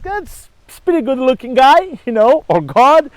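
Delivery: lightly voiced smitten young woman; impressed. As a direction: play the girl won over by his looks. Cassandra sizes up Apollo.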